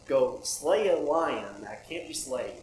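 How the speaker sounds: ordinary talk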